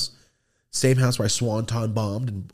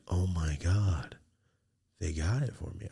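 hushed awe; 5 semitones lower, 7 dB quieter than usual. Retold or imitated. Kid holding the gift he hoped for, hushed awe. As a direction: let it sink in quietly.

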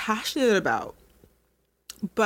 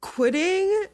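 Quitting lifted high and held; emphatic Quitting; 10 semitones higher than usual. Real, not acted. To viewers who keep quitting, stressing Quitting. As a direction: land Quitting as the big reveal. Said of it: "let me tell you this".

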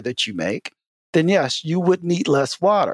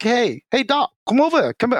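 higher-pitched imitation of a beckoning guide; raised pitch, mock calling out; calling someone over; 8 semitones higher than usual. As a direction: beckon the doctor over in a lightly acted voice. Retold zoo guy calling doctor over.

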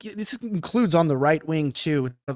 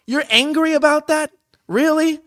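much higher and louder, disbelieving; can't believe anyone is angry at this; loud, high mock disbelief at the outrage; incredulous; 9 semitones higher, 5 dB louder than usual. Real, not acted. Mocking outrage over a joke.

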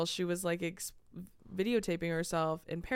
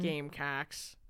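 Gamecocks in a lower Wisconsin accent; 3 semitones lower, 4 dB quieter, slower than usual. Shown as a demonstration. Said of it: "Like that".

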